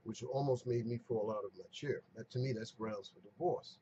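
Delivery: ordinary talk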